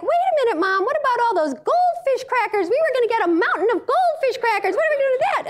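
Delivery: high-pitched mock kid whine; protesting children. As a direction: imitate a child pleading with mom. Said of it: "your children are going like".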